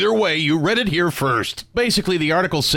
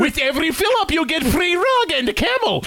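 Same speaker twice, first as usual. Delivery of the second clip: higher, hammed-up salesman pitch; mock ad pitch; 9 semitones higher than usual. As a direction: pitch the free camel like a cheesy ad. Mock gas station ad.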